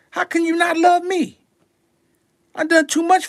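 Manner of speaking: high, far-off mock plea; mock hurt plea. Boasting rapper playing hurt, high mock plea. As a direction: squeak it out like an injured star.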